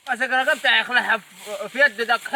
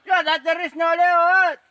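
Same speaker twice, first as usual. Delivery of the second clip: ordinary talk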